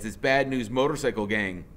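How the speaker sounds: plain reading voice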